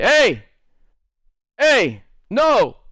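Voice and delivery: high, sharp mock calls; warning shouts. Teacher breaking up the crowd, high sharp calls. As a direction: cut them off with sharp calls.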